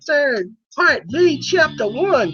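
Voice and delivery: high, sassy drag diva voice; sassy drag queen. Acted. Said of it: "My drag queen name, honey, is Audrey Monroe".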